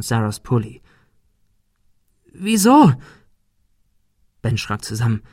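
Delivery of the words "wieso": high, loud demand; shrieked reproach. A narrator's reading. Girl confronts the boy who hid, shrieking. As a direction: cry out the question accusingly.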